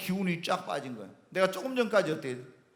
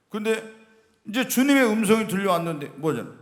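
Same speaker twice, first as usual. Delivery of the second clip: ordinary talk, nothing marked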